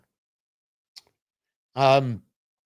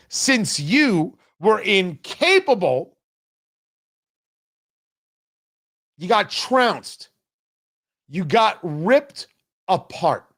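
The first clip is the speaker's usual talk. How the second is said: slow mocking; 2 semitones higher, slower than usual.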